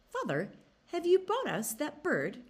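put-on high, eager young fox voices; young cubs begging their father. A narrator's reading.

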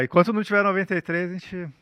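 usual speaking voice

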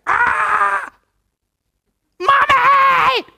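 put-on very high, loud shriek of a hurt child crying for mommy; child's pained scream. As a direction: scream like a little kid whose finger is caught. Child caught in trap.

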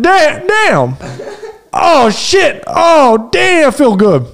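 Richard Pryor impression. Imitating Pryor in a joked-up scene, high laughing voice. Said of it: "Richard Pryor".

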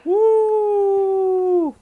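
excited cheer. Real, not acted. Cheering at podcast start.

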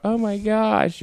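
high-pitched mock panic; imitated freak-out. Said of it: "people are yelling and screaming, freaking out".

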